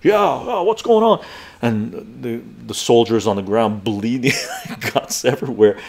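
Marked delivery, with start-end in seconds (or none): 0.0-1.2 cowboy officer. Retold or imitated.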